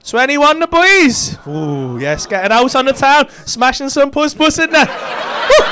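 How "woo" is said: short, high party whoop. Pretend. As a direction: whoop like you're out on the town. Cheering the lads' night out.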